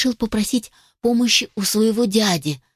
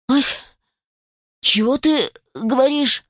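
put-on raised-pitch old man's query; hard-of-hearing grandad; 4 semitones higher than usual. A narrator's reading. Old grouse did not hear the thanks, puzzled. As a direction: ask as if you didn't catch it. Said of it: "не расслышал дед".

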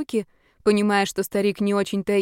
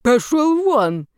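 slow, much louder angry bark; 6 semitones higher, 6 dB louder, much slower than usual. Acted. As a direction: bark him out of the room. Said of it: "рявкнул на него старик". (громкий злобный рык)

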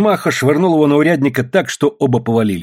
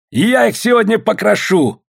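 high-pitched defiant shout; fighting threat; 10 semitones higher than usual. A narrator's reading. Андрюшка boasting amid the brawl, high shout.

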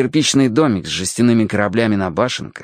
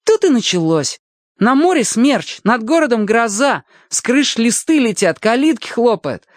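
put-on high, animated boy's storytelling; excited boy; 13 semitones higher than usual. Boy tells Alyoshka about the weather-vane prank, excited high voice. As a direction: revel in telling the mischief, pitched high.

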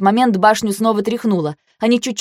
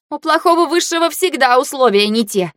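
higher-pitched, huffy retort; puffing; 6 semitones higher than usual. A narrator's reading. Heroine retorting as she runs downstairs, puffing. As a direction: huff it back while running. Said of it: "пропыхтела я в ответ".

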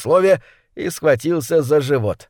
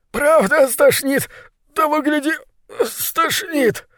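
panicked ratcatcher; 12 semitones higher, slower than usual. Acted. Ratcatcher panicking, about to vomit.

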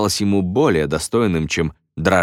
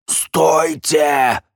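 high, strangled cry; choked croak; 7 semitones higher than usual. A narrator's reading. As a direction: cry out through a squeezed throat. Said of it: "прохрипел Редж".

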